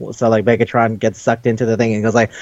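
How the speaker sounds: ordinary talk, nothing marked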